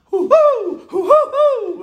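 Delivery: high hooting owl reply; answering owl call. Retold or imitated. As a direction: hoot back like a second owl. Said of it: "goes back in response".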